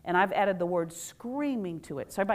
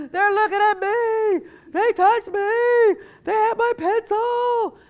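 put-on much higher, louder, slow complaining child; whiny kid; 12 semitones higher, 9 dB louder, much slower than usual. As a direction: whine like a kid tattling on classmates. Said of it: "That's why everything's drama".

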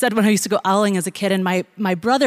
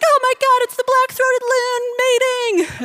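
excited crowd; 3 dB louder than usual. Retold or imitated. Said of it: "people would be like".

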